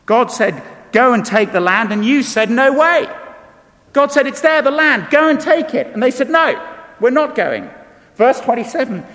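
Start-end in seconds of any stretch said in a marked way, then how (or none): 0.1-7.7 higher and louder, defiant No way; retold standoff between God and people; dramatized exchange. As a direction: hurl the refusal back at God. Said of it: "voices God and defiant people".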